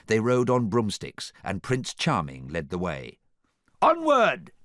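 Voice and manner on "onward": high, loud battle cry; rally the villains onward; grand commanding shout. A narrator's reading. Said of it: "he cried".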